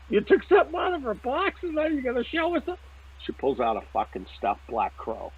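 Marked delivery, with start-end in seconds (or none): 0.1-2.6 much higher, amazed voice; incredulous. Retold or imitated. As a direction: ask it half-laughing, utterly amazed. Incredulous at wife taking object.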